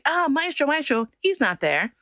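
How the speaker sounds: high, mock-puzzled call for the maestro; searching organizers. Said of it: "They're looking around like".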